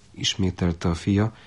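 ordinary delivery, nothing marked

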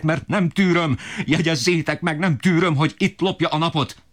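very high, ferocious tirade; father turning his fury on his son, high-pitched rage. A narrator's reading. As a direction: point at the boy and tear into him. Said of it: "Apám erre még jobban megbőszült".

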